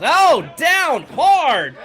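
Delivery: slow, loud, high "oh, down hard"; excited shout. Acted. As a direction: yell the slam for the crowd. Big slam in the match.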